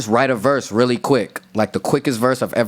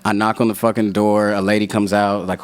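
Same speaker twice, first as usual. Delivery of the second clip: normal talking voice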